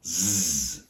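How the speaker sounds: a held, buzzing Z sound; voiced Z. Shown as a demonstration. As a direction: buzz a long "zzz". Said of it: "vocal cords are vibrating".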